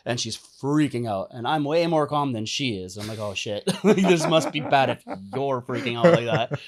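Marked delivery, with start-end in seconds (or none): 3.2-6.6 laughing. Retold or imitated. Calm rider laughing at panic.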